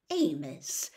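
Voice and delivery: plain reading voice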